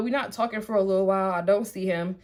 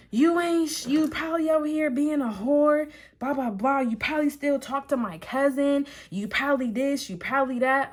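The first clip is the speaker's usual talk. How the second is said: higher, mocking rant; reckless accusing ex; 6 semitones higher than usual. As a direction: rattle off his accusations in a put-on ex voice. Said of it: "talking reckless to me".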